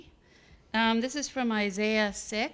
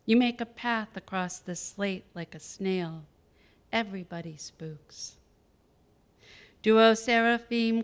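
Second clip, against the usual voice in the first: louder, measured poetry-reading voice; poem reading; 2 semitones higher, 6 dB louder than usual. Acted. Poet reads from a first book, measured poem reading. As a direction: recite it evenly, line by line.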